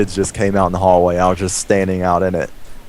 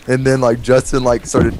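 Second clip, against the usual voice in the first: usual speaking voice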